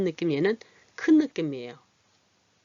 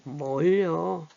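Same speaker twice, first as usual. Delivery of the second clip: drawn-out bashful 뭘요; modest reply; much slower than usual. Acted. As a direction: shrug off a compliment, very slowly. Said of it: "요런 예를 썼어요".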